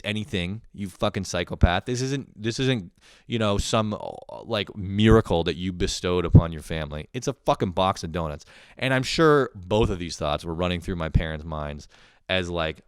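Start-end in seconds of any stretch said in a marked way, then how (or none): none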